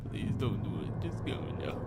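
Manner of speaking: desperate whispered mumble; whispered plea. Acted. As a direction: beg under your breath, terrified. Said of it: "He mumbled something".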